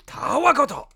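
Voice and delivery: loud, high Japanese bad guy; villain voice. Shown as a demonstration. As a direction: bark it like an anime villain. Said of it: "the bad guys goes".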